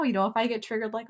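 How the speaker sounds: usual speaking voice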